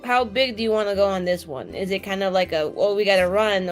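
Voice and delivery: ordinary delivery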